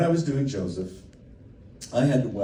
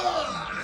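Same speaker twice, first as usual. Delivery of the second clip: mock pained yelp; 3 dB quieter than usual. Retold pain of wax strip.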